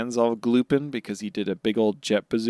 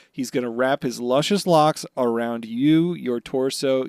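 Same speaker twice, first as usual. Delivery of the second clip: ordinary talk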